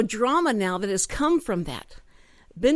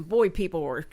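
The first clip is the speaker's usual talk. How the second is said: ordinary delivery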